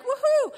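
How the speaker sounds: high, loud exclamation of joy; preacher cheering over the promise; joyful woohoo. Real, not acted.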